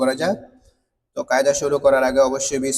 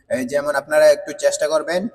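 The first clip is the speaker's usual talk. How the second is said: ordinary talk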